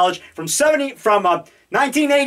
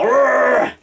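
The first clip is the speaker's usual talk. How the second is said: monster growl; 2 semitones higher than usual. Acted. Alligator monster attacking.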